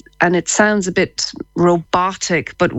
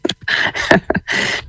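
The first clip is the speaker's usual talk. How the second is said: breathy laugh. Real, not acted. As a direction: laugh under your breath. Guest laughs at the challenge.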